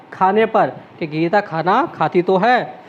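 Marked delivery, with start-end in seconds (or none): none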